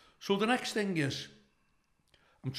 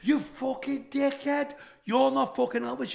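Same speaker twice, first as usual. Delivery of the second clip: crowd screaming abuse; 8 semitones higher than usual. Retold or imitated. Punters abusing the Elvis act, high jeering yell. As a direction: scream the insult at the stage like a rowdy punter. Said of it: "everyone's screaming".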